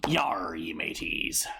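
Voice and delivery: low, slow growling pirate greeting. Pretend. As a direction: drawl it out like an old sea pirate. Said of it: "talk like a pirate".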